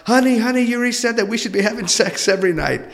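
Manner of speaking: hammed-up eager partner running to tell; excited spouse. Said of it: "go on running to your spouse".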